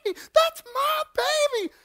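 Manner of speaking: very high, loud imitated scream; piercing drawn-out mock shriek; screaming mother. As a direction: imitate a frantic woman shrieking from a distance. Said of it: "and I'm still hearing".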